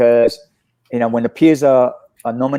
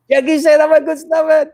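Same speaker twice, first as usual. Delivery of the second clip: overjoyed shout. Retold or imitated. Retelling his own joy at Jackie's praise, shouting high. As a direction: yell it like you just won an Oscar.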